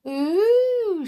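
high, drawn-out taunting ooh; mocking. A narrator's reading. Matron taunts George, mocking. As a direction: goad him with the sound. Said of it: "she added mockingly".

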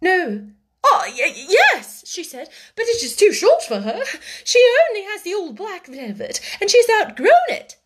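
high, stammering, anxious voice; timid, panicky explanation; flustered fright. A narrator's reading. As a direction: blurt it out nervously, pitched high. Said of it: "began to turn pale".

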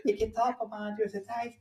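high, laughing mock protest; giggly high-pitched whine; Erlend Elias impression. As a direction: squeal it like a squeamish celebrity. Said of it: "Da sier jeg som Erlend Elias".

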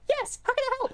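very high mock-helpful squeak; squeaky voice. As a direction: squeak it like an over-eager supervisor. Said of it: "Just voice squeaking".

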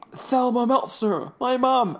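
gasp, then high, slow, loud cry of shock; hammed-up horrified recognition; mock freak-out. [name] finding his mom's tombstone, hammed-up shock. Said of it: "[name] starts freaking out".

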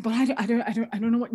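imitated anxious stammering; flustered panic. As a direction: stammer like a rattled caller needing advice. Acting out panicked sponsee asking advice.

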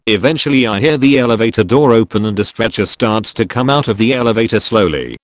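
ordinary delivery, nothing marked

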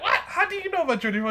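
high, fast, incredulous question; retold astonishment. Traveller retelling being asked in Africa, high, quick mock astonishment. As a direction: act out being taken aback, pitch jumping up.

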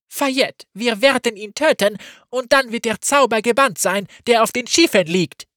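high, loud gloating; cruel triumph. Acted. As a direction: relish the coming kill. Saba gloats over killing Gavin.

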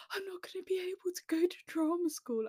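high, hushed tearful wail; whispered high despair. Retold or imitated. Crying to herself over a school detention. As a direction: squeak it out like a crushed teen.

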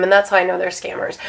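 ordinary delivery

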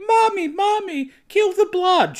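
put-on high, loud whiny little boy. Recapping the movie, the son's voice as a little boy. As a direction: play a small boy begging his mommy. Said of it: "a little boy voice".

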